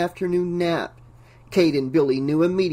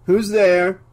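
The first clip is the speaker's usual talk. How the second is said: distant voice; 3 semitones higher, 6 dB louder than usual. Acted. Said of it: "a distant voice asked".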